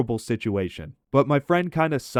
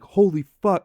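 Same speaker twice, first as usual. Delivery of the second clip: higher and louder shocked cry; shocked outburst; 8 semitones higher, 7 dB louder than usual. Retold or imitated. Narrator reacting as his friend pukes in the taxi, shocked outburst. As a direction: blurt it in horror as the puke hits.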